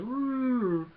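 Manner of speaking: high, loud rising-falling woo; drawn-out mock creature wail; imitated Wookiee howl. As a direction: howl back like Chewie. Imitating Chewie's answering howl.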